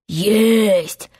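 drawn-out captain's reply; Hook imitation. A narrator's reading. Peter still posing as Hook, drawn-out reply. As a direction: answer as a mock pirate captain. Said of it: "всё ещё голосом самого Крюка".

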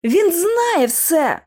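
high, slow emphatic exclamation; sudden high-pitched realization; exclaiming. A narrator's reading. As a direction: cry it out, stretching the words. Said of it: "вигукнула я".

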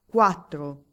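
loud, falling announcer call; a lottery crier shouting the draw; shouted number. A narrator's reading. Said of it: "il banditore grida".

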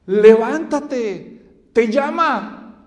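loud, slow, drawn-out call; urgent summons. Retold or imitated. Crowd telling Bartimeo to rise, urgent loud summons. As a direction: call out to rouse him.

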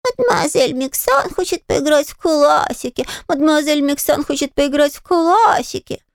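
mock him in a squeaky sung voice; thin high voice. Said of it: "запел тоненьким голосом". (тоненький дразнящий голосок)

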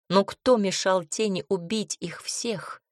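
ordinary delivery

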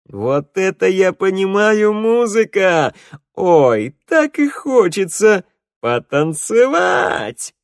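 slow, high, loud delight. Acted. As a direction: burst into joy at the tune. Kobzar delighted by music.

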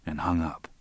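usual speaking voice

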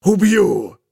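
furious roar. Acted. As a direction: bellow it out in powerless fury. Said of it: "заревел в бессильной ярости".

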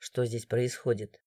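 low, quiet, slow authority; deep, unhurried official calm; confident, calm guard. A narrator's reading. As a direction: ask it softly, sure of being obeyed. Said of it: "раздался уверенный, спокойный голос".

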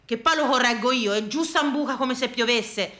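ordinary delivery

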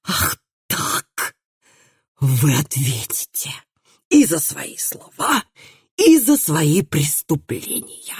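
slow, strangled growl of indignation; seething growl, word by word; outraged growl. A narrator's reading. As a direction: choke the words out slowly, gasping with outrage. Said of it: "Наконец прорычал он".

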